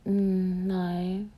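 small, soft, drawn-out no; reluctant admission. Retold or imitated. Man sheepishly admitting his girlfriend doesn't know, quiet and reluctant.

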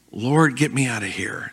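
soft, low muttered plea to God; a hushed, half-joking prayer; mock desperate prayer. Father at the water park praying under his breath. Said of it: "I am praying".

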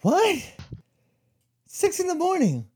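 whiny incredulous self. Retold or imitated. Woken at six by her ex for a hike, high whiny protest. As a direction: squeal it like being dragged out of bed at dawn. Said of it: "And I'm like".

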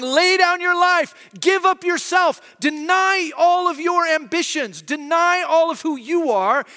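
Jesus calling his followers to give everything, slow and shouted; emphatic call. Retold or imitated. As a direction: lay down your life, slow and shouted high. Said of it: "Jesus is going".

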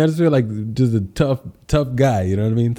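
ordinary talk

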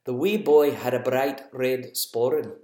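Scottish accent again, rolling the words. Shown as a demonstration. Parent repeating the Scottish accent so kids can guess. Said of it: "I'll say it again".

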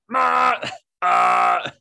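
high, loud yelled "Ma, ah"; shouted character syllables. Retold or imitated. Upstairs above a business recording frames, loud high yell. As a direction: belt it so downstairs can hear. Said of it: "I'm upstairs going like".

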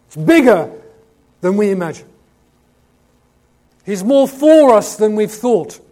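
loud preacher hammering each key word; emphatic preaching. Real, not acted. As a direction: drive it home from the pulpit. Preacher at full force.

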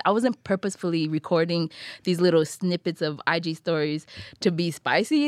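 ordinary talk, nothing marked

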